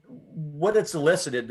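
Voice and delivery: usual speaking voice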